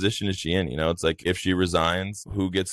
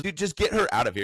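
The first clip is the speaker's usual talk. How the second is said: higher, fast exasperated mock voice; mock judge; 9 semitones higher, faster than usual. Judge ordering the lawyer removed, fast mock exasperation. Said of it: "And just".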